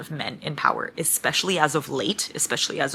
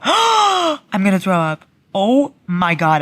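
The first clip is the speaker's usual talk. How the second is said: hyperventilating; 3 semitones higher, 5 dB louder, slower than usual. Real, not acted. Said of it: "I'm hyperventilating".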